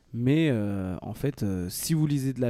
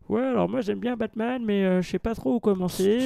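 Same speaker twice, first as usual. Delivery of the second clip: voix de client perdu, nettement plus aiguë; client hésitant imité; 11 semitones higher, 4 dB louder than usual. Acted. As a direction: imite un novice qui demande conseil, perché dans les aigus. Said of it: "il y a un gars". (client hésitant, voix haut perchée)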